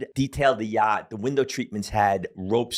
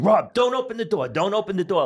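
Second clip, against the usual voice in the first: retold scream; 9 semitones higher than usual. Girl screams at Rob not to open the door, panicked shout. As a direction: yell the warning at him in panic. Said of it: "the girl starts screaming".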